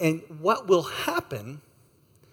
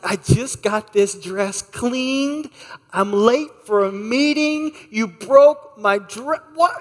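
higher, slow, building mock outrage; angry wife; 6 semitones higher, 4 dB louder, slower than usual. Wife scolding husband over broken zipper, mock angry. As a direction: let her indignation climb word by word. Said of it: "she was so mad".

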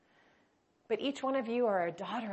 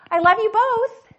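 much higher and louder, in an altered voice; dying mother's fervent high goodbye to both; zealous farewell; 12 semitones higher, 12 dB louder than usual. Retold or imitated. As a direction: lift it high and loud with fervent love. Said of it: "a zeal in her voice".

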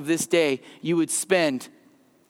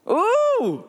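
surprised ooh; 12 semitones higher than usual. Real, not acted. Reacts to the scripture line.

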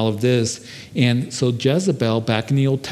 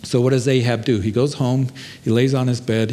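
usual speaking voice

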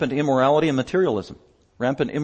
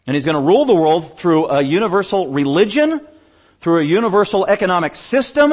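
higher, louder pulpit delivery; emphatic preaching; 6 semitones higher, 5 dB louder than usual. Real, not acted. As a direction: preach it with rising force. Preaching with rising force.

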